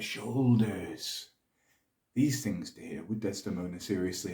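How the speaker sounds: measured, dramatic Shakespearean delivery; stately verse speech, swelling and dropping with the tale; Othello. Acted. Othello defends his marriage before the senators, measured and theatrical.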